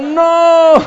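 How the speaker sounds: desperate crowd shout. Retold or imitated. Teammates shouting as he scores on his own goal, laughing yell. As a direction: yell in despair, half laughing. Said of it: "están diciendo".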